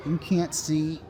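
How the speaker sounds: ordinary talk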